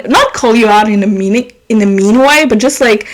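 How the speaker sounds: usual speaking voice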